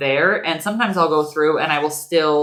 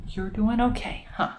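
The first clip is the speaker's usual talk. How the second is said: normal talking voice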